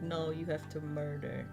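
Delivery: ordinary talk, nothing marked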